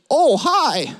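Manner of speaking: high-pitched mock Canadian greeting; Canadian accent. Imitating Canadians at the cafe, mock Canadian accent. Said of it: "They would say".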